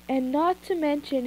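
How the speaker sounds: ordinary delivery, nothing marked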